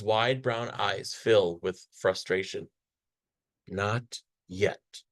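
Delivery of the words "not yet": soft, hushed and stubborn; whispered, frustrated. A narrator's reading. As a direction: breathe it out under her breath. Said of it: "she whispers".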